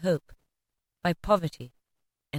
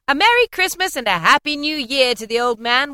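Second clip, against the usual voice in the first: higher, louder jolly wish; merry toast; 7 semitones higher, 6 dB louder than usual. A narrator's reading. Nephew toasting the absent uncle, merry and loud. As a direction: wish it to the whole room. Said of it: "said Scrooge's nephew".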